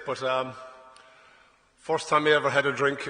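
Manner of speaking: ordinary talk, nothing marked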